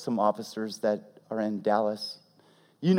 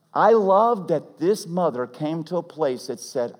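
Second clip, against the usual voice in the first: ordinary talk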